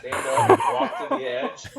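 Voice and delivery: words through laughter; laughing hard while talking. Real, not acted.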